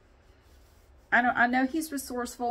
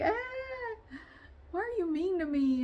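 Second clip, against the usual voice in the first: voicing the cat, much higher and plaintive; hurt cat voice; 11 semitones higher than usual. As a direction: play the bullied cat, hurt and puzzled.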